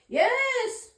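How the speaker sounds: high, loud cry of triumph; excited yes. Real, not acted. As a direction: exclaim as the card lands. Card confirms her message.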